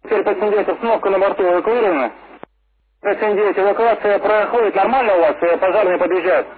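urgent emergency radio call. Real, not acted. Controller during emergency evacuation.

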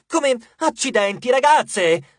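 loud, high-pitched snap; exasperated outburst. A narrator's reading. Leo besieged by the girls' questions, exasperated outburst. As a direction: burst out at being questioned. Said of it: "sbottò lui".

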